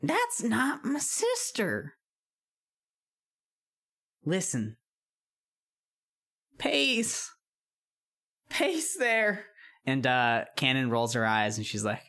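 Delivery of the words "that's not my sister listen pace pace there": witch voice. Acted. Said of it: "witch voice continues".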